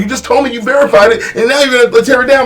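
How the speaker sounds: building from exasperated to high-pitched outrage; outraged. Retold or imitated. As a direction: climb into indignant disbelief. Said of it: "I'm like".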